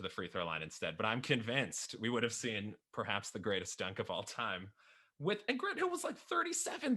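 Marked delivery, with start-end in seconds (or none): 5.2-6.9 amazed. Real, not acted. Marveling at an aging star's dunk.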